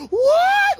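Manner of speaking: a high, loud, stretched shocked yelp; a stunned yell at the cliffhanger; shocked what. Retold or imitated. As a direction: yelp it out in total disbelief. Speaker's shock at the comic's ending.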